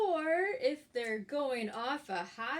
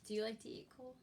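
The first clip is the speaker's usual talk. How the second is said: plain reading voice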